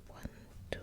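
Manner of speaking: ordinary talk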